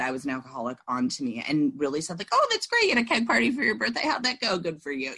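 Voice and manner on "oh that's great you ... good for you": high, gushing imitation; hammed-up cheerful friend; upbeat mock praise. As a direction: gush sweetly like it's great news. Imitating members' upbeat reply.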